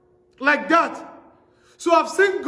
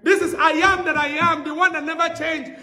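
raised, ringing preaching to the crowd; full-force preaching; 4 semitones higher than usual. Acted. Preacher to his congregation, ringing proclamation. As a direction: proclaim it to the whole church.

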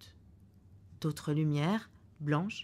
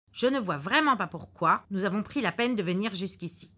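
put-on louder, dragged-out grumble of an old man; irritated husband; 4 semitones higher, 8 dB louder than usual. Old husband scolding his wife's alarm, loud and grumpy. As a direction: grumble that the fuss is pointless.

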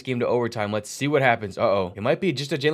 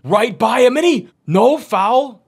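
high, loud cry, drawn out at the end; excited disbelief; 6 semitones higher, 6 dB louder, slower than usual. Real, not acted. Commentator as no foul is called, excited disbelief. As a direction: call the drive loudly, then protest. Drive without foul call.

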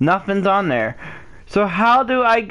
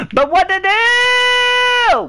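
high, drawn-out wail of despair; whiny, stretched-out cry of frustration; frustrated wail; 14 semitones higher, 4 dB louder, slower than usual. Real, not acted. Frustrated gamer wailing for help.

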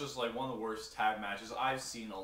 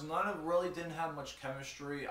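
normal talking voice

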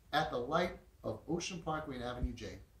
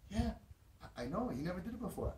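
put-on higher, halting voice of an amazed boy; freaking out; 7 semitones higher than usual. Amazed boy answers his driver's doubt, high stammering wonder. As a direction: imitate a boy still in disbelief. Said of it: "He's freaking out".

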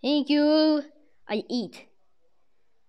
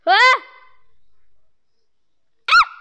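high, loud panicked shriek; a panicking gamer screaming; 12 semitones higher, 9 dB louder than usual. Real, not acted.

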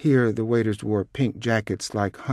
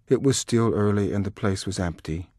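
ordinary delivery, nothing marked

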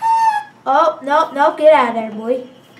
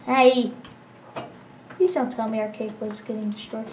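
usual speaking voice

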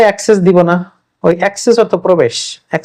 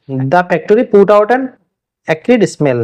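slow dictation; much slower than usual. Shown as a demonstration. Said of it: "example sentence".

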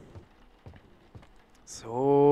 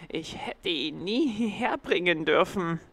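cat's regret; 6 semitones higher, 4 dB louder than usual. A narrator's reading. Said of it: "rief die Katze".

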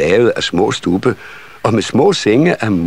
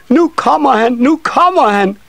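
put-on high, squealing nisse children; excited child shout; 4 dB louder than usual. A narrator's reading. Nisse children announcing the visitor, high excited shout. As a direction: cry out as an eager little child, very high. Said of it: "råbte nissebørnene".

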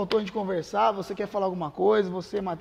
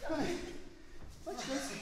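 panting after sparring; winded, catching breath; 7 semitones higher, 7 dB quieter, slower than usual. Real, not acted. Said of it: "esse negócio cansa".

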